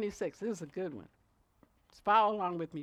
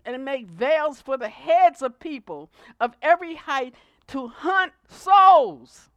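loud proclaiming, rising to a shout on souls; shouted scripture; 6 semitones higher, 13 dB louder than usual. Acted. Preacher reading Ezekiel to the congregation, shouting. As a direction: thunder the prophecy.

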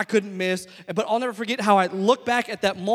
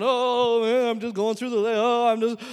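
exaggerated whiny moan, pitched up; mock moping; 3 semitones higher, 5 dB louder than usual. Brother mimics his moping little brother, mocking. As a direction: imitate a mopey player, loudly overdone. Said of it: "you're moping around".

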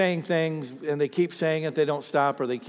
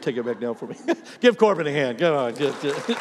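usual speaking voice

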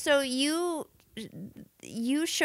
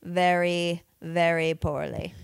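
slow, measured alien pronouncement; mock alien; 4 semitones lower, 4 dB louder, slower than usual. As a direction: draw each word out like a solemn alien. Joining in the alien proclamation bit.

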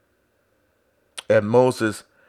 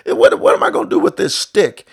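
much higher, incredulous complaint; exasperated Moses; 6 dB louder than usual. Retold or imitated. Moses complaining to God, high exasperated whine. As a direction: whine in disbelief at the stick. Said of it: "if he would have said".

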